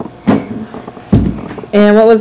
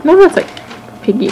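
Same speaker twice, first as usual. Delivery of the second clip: ordinary delivery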